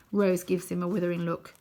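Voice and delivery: plain reading voice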